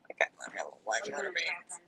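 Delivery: low breathy whisper as the cat; little cat in a hush. Pretend. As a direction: whisper the cat's boast. Voicing the cat.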